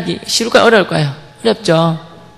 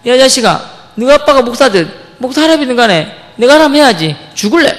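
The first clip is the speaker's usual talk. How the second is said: raised, louder mock-furious scolding; higher-pitched, forceful retold threat; retold angry outburst; 5 semitones higher, 4 dB louder than usual. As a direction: play a bully losing his temper. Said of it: "제가 더 화를 더 냈어요".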